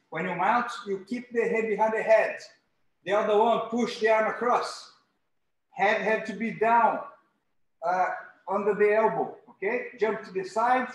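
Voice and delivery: ordinary talk, nothing marked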